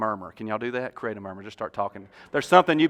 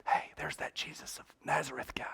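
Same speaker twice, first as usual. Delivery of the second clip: hushed, much softer aside; whisper; 14 dB quieter than usual. Acted. Somebody in the crowd spotting Jesus, whispering. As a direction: pass word along in a whisper. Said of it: "they just heard somebody whisper".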